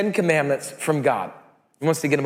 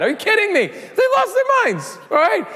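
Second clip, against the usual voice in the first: mock outrage. Real, not acted. Preacher telling the congregation the kids lost their minds, laughing disbelief. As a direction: cry out in amused exasperation.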